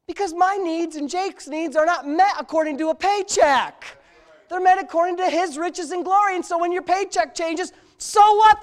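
high, loud sermon build; preacher rousing the congregation; full-force preaching. Acted. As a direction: preach it up to the crowd.

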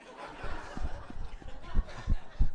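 loud breathy burst of laughter. Retold or imitated. Laughing at stoplight.

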